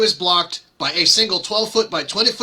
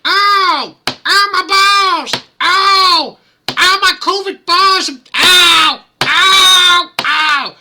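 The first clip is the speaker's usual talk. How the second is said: high, loud cries of pain; pained yelps; 11 semitones higher, 6 dB louder, much slower than usual. Pretend. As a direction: yelp like you just got hit in the groin. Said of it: "It's gonna sound like".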